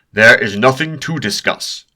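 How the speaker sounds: loud booming declaration, voice filling the room. Acted. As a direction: boom it out to shut the talk down. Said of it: "Doctor Thomas boomed".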